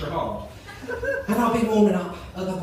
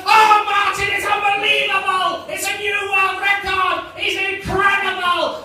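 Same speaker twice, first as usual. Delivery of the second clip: high, loud, stretched-out Scottish commentary shout; ecstatic sports commentator; 12 semitones higher, 6 dB louder than usual. Acted. Co-commentator telling Martin of a world record, hammed-up ecstatic shouting. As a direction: roar a record-breaking win. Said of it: "He goes".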